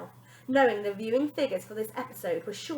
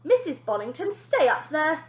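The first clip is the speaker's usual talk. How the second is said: ordering; 7 semitones higher, 7 dB louder than usual. A narrator's reading. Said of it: "ordered Wonder Nanny".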